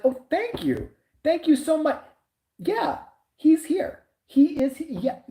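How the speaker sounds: high, gushing polite voice; mock overly grateful; gushing thanks. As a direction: imitate an effusive thank-you. Imitating Steve thanking stranger.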